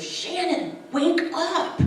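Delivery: high, loud wake-up call, imitating a nurse; really stern. Nurse on the phone waking the wife, stern. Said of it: "she got really stern".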